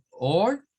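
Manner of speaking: American or; rhotic or. Shown as a demonstration. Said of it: "repetimos estos".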